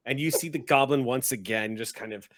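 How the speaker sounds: normal talking voice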